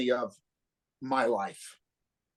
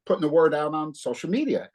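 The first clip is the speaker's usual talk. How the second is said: ordinary talk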